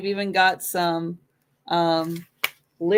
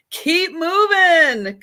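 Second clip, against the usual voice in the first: urging bandmates; 8 semitones higher, 5 dB louder, much slower than usual. Retold or imitated. Guys urging [name], retold.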